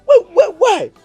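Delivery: high, pained demand; why, why, why at his mother; angry outburst. Acted. Brother angry about his sister.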